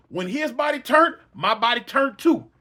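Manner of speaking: ordinary delivery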